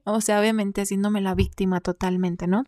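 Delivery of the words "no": normal talking voice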